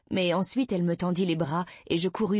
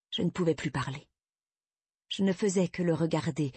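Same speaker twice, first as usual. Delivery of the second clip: ordinary delivery, nothing marked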